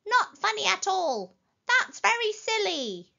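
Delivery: high, emphatic scolding; indignant protest. A narrator's reading. Rabbits popped from their hole complain, indignant. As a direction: hammer each phrase high and cross.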